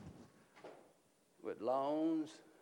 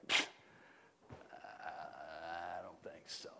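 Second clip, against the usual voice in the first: Jonah; 13 semitones lower, 12 dB quieter than usual. Acted. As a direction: stretch the first word, then quietly decline. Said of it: "What did Jonah say?".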